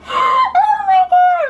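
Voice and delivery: very high, loud, drawn-out squeal; delighted squeal. Real, not acted. Host reacts to Josh's parting blessing, delighted squeal.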